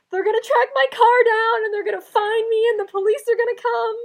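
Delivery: loud, high panicked rush. Retold or imitated. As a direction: spiral into mock panic out loud. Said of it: "I'm like".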